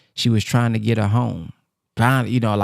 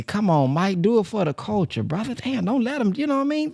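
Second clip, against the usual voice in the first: high, urgent plea to Mike; begging him not to lose; pleading; 8 semitones higher than usual. Real, not acted.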